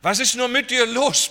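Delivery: desperate mother shouting at her son; retold yell. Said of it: "brüllte sie". (verzweifeltes Brüllen, viel höher und lauter)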